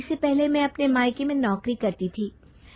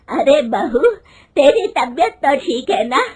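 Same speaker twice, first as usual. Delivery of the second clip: loud, higher angry scolding; 5 semitones higher, 7 dB louder than usual. A narrator's reading. Mother-in-law rebuking daughter-in-law for wanting a job, loud angry. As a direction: shout her down in outrage. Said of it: "गुस्से में कहती है".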